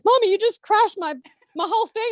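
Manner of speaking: put-on high, complaining little boy; whiny child. Son complaining mum crashed his build, high childish whine. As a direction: whine like a kid whose game got wrecked.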